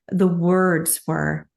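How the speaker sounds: normal talking voice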